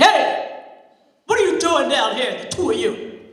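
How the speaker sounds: loud gruff yell; loud angry neighbor yell; angry shout. Acted. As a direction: catch the kids red-handed. Neighbor catches kids.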